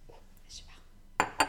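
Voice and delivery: slow unvoiced whisper. Real, not acted. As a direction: whisper it after sipping. Whispered after first sip.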